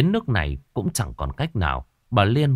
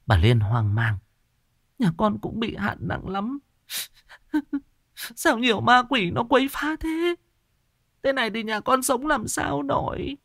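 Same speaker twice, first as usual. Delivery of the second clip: put-on pleading old woman; 12 semitones higher than usual. Old woman begging the shaman, high whining plea. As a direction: whimper and beg. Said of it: "bà Liên khóc lóc".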